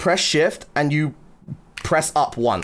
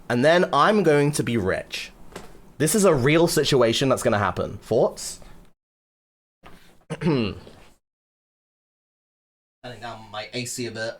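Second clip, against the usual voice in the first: normal talking voice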